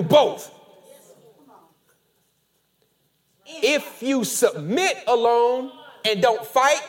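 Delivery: loud, slow, rising preacher's cadence; preaching at full force. Acted. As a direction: hammer each "and fight" home.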